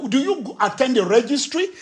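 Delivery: ordinary delivery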